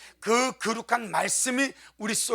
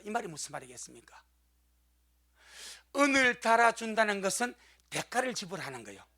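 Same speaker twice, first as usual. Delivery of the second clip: usual speaking voice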